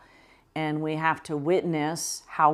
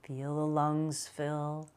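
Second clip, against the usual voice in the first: guided meditation; 5 dB quieter than usual. Acted. Guides a slow breathing meditation.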